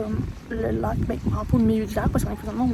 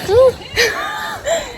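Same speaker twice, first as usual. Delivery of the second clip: shrieking laugh; 5 dB louder than usual. Real, not acted. Excited after the jump.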